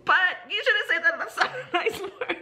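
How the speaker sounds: high-pitched, through laughter; laughing scolding. Retold or imitated. Teacher correcting a student's swearing, laughing. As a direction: scold while failing to hold back giggles.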